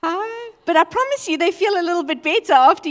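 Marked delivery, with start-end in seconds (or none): 0.0-0.5 mock confused hi; puzzled reply. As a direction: answer a stranger warily. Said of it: "and they're like".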